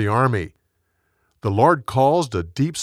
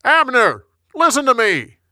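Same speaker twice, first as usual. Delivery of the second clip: high, loud call across distance; yelling; 11 semitones higher, 5 dB louder than usual. A narrator's reading. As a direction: yell to rouse a sleeping camp. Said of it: "David yelled at Saul's camp".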